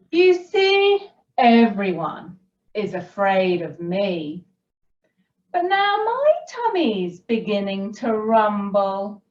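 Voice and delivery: slow, animated storyteller; story reading to children. A narrator's reading. Storyteller reading the Gruffalo to children, animated and unhurried. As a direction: read it slowly with big pitch swoops and pauses.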